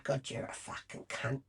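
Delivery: whispered under the breath; whispered insult. Retold or imitated. To the woman who scared Zelda off, whispered. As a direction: hiss the insult so only she hears.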